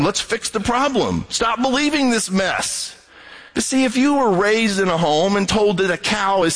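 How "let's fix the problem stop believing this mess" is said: a hint of an impatient know-it-all; blunt American. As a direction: brush off the belief. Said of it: "That's the rational American".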